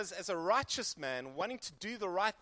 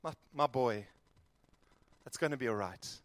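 comforting father; 6 semitones lower than usual. Pretend. The Lord comforting Jeremiah, low and tender. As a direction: gently comfort a hurting son. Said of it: "I would want to comfort him".

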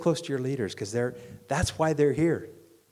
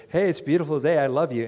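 higher and louder, bouncy greeting; mock cheerful; 4 semitones higher, 6 dB louder, faster than usual. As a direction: skip up and chirp it happily. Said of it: "could skip up to you and say".

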